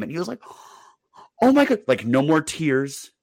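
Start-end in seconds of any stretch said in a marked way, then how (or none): 1.4-1.8 put-on kid's delight. Kid thrilled by news.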